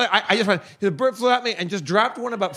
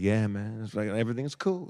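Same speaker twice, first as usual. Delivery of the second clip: low, soft, mellow surfer voice; easygoing low murmur, quietly chilled out; Matthew McConaughey; 8 semitones lower, 8 dB quieter than usual. Retold or imitated. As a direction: lightly imitate a laid-back surfer dude. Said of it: "he's like Matthew McConaughey".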